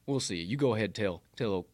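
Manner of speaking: low, quiet coach impression; folksy recruiting pitch, soft and deep; Saban. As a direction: talk a recruit round in a low, understated voice. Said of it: "He's spot on".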